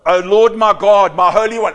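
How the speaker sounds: loud prayer. Acted. Preacher voicing Habakkuk's prayer loudly.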